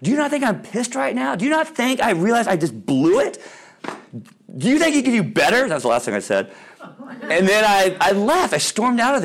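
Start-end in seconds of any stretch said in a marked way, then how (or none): 0.0-5.7 erupting at dad. Retold or imitated. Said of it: "And I erupted".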